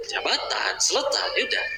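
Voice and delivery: whispered punchline. Acted. Preacher whispering the punchline to the congregation. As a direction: drop it to a whisper.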